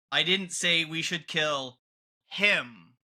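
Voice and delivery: "him" set apart after a pause; pause, then land firmly on "him"; stress on HIM. Shown as a demonstration. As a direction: hold back, then stress "him" alone at the end. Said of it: "emphasize a different word in the sentence".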